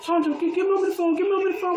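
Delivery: high-pitched, frantic imitation of a crying caller; imitated crying plea. Crying sister begging over the phone, mock-frantic. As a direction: retell her tearful plea up high. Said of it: "and she was crying".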